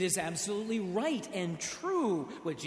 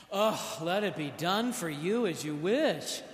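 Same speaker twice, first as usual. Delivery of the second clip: loud, exasperated brush-off; mock Jesus waving her away; dismissive tone; 3 semitones higher, 6 dB louder than usual. Preacher mocking an impatient Jesus. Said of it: "I do not think that Jesus said it in this tone".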